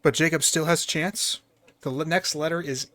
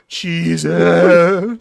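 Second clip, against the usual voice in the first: imitated moan, swelling louder on the uh; mock moaning; 10 dB louder than usual. Host mimics a player saying cheese, mock moaning. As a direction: moan the word out, hammed-up. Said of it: "you're moaning".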